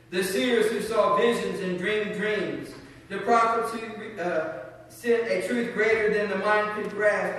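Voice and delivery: solemn closing blessing. Real, not acted. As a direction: proclaim it like a blessing over the people. Reads benediction to standing congregation.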